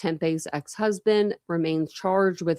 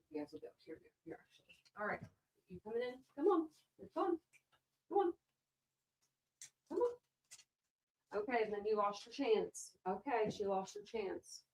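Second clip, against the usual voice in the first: pet talk voice; 4 semitones higher, 16 dB quieter than usual. Real, not acted. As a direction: coax the dog sweetly. Coaxing dog to come in.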